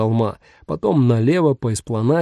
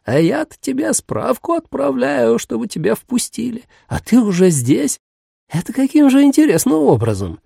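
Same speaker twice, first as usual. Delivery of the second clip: put-on high cracked old woman; frail, cracking grandma voice; old grandmother voice; 10 semitones higher than usual. A narrator's reading. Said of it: "надтреснутым голосом".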